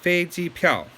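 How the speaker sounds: "飞机票" high and level, then falling on the last syllable; first, first, fourth tone. Shown as a demonstration. Teacher recapping the pinyin for learners, "飞机票" in demonstrated tones. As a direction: keep "飞机" up high, let "票" drop sharply. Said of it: "first tone, first tone, fourth tone".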